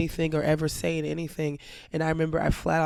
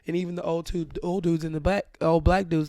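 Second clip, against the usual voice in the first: ordinary delivery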